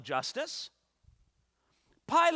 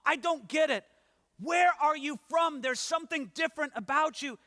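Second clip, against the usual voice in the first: higher, pressing, baffled governor voice; pleading Pilate; 7 semitones higher, 3 dB louder than usual. Acted. Preacher voicing Pilate pressing Jesus for a reason, baffled and pleading. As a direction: push him for any answer, half begging.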